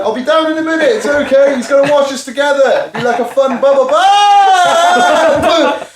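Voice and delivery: high, squeaky reassuring object voice; cartoonish high bowl voice. Voicing the bowl.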